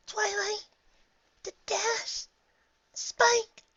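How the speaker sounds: high, broken wail of names. Acted. Fluttershy wailing for her lost friends, high and broken. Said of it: "inarticulately wailed".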